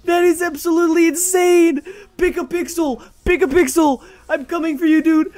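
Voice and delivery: high, loud crate-opening freak-out; hammed-up YouTuber excitement; hyped-up screaming. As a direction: shriek in over-the-top disbelief at a lucky win. Acted crate-opening freak-out continues.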